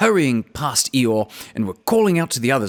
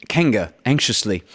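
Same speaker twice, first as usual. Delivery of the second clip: usual speaking voice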